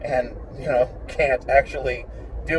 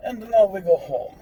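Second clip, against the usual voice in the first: higher-pitched put-on Russian accent; 5 semitones higher than usual. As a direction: play a homebound Russian, accent and all. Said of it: "I can't do my Russian accent".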